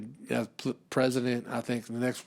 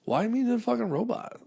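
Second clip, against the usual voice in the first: high, pleading outrage; pitched-up indignant plea; mock outrage; 11 semitones higher than usual. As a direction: scold them for bullying. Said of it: "And I was like".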